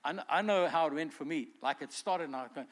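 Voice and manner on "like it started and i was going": ordinary talk, nothing marked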